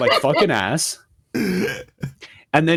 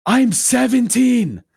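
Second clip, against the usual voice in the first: exasperated; 10 semitones higher, slower than usual. Real, not acted.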